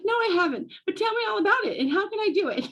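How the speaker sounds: high, eager and breathless to learn; hammed-up keen young shop owner; mock eager. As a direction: jump at the offer, voice pitched right up. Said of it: "I'm like".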